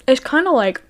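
talking with a mouthful; words around a bite of chips; chewing while talking. Real, not acted. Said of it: "sorry, I'm chewing".

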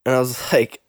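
ordinary delivery

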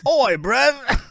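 high, loud, hammed-up Cockney; Cockney accent. Shown as a demonstration. Showing the co-host a recognisable voice, hammed-up Cockney. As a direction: put on a comic Cockney voice. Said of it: "I do my Cockney accent".

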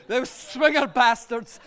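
slow, loud contempt; heavy, indignant outburst; bitter cursing. Acted. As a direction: curse them, leaning on each word. Cursing rival entertainers.

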